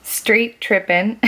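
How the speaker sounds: normal talking voice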